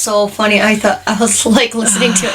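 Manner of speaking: ordinary talk, nothing marked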